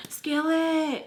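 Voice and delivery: high-pitched mock yell; retold scream. Calling friend to rescue her, imitated scream. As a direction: holler the name up high, without full force. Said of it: "I'm, like, screaming again".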